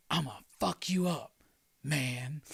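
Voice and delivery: softly spoken hero parody; mock superhero threat. Superhero answers the robber.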